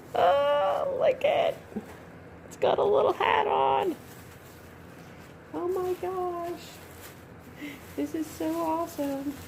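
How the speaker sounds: gasp, then high awestruck squeal; delighted squeal. Real, not acted. Collector unveiling the baby's head, squealing with delight. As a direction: melt into high-pitched cooing delight. First sight of doll's head.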